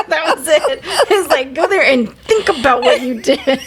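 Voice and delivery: high, giggly retelling; laughing. Real, not acted. Daughter recalling being sent to her room without dinner, laughing. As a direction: talk through real laughter.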